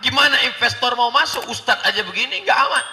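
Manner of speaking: high, loud outcry in alarm; alarmed onlooker. Acted. Onlookers reacting to the crowd, high loud alarm. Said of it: "orang menyangka".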